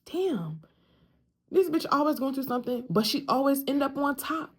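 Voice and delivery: a hint of catty onlookers, rushed; mocking gossips. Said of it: "they can't figure out".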